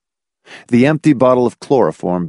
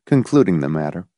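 ordinary delivery

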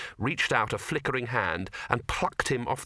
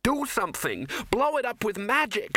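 much higher desperate shout; Ginger shouting; 11 semitones higher than usual. Acted. Ginger shouting at the wizards to use magic, desperate. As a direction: yell it up high and urgent. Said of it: "shouted Ginger".

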